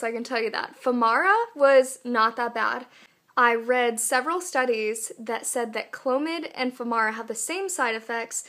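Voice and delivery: usual speaking voice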